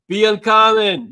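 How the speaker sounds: slow, loud rallying cry; shouted motto. Real, not acted. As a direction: belt out the motto slowly.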